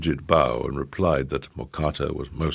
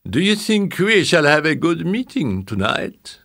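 high voiced grand old madame; old French lady; 12 semitones higher, 6 dB louder than usual. A narrator's reading.

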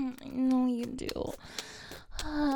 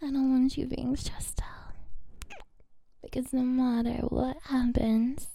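sweet nothings; 2 semitones lower, 5 dB quieter than usual. Acted. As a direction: whisper tenderly, winding down. Tender afterglow, loving words.

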